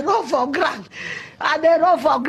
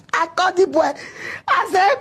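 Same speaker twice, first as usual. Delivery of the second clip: normal talking voice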